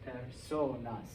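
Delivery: personas slowed, leaning on SO; stress on O. Shown as a demonstration. Teacher finds the stress for students, personas stressed on SO. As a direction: draw out the SO in personas, then drop. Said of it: "Yeah, it's on O".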